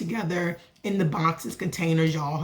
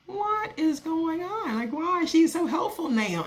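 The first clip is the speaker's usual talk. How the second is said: baffled; 10 semitones higher than usual. Retold or imitated. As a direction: play the stunned onlooker. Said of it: "And I'm like".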